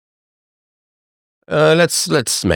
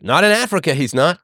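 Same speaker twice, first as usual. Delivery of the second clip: pitched-up defiant reply; mock defiant reply; 7 semitones higher than usual. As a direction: toss it back, pitched up. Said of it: "It's like".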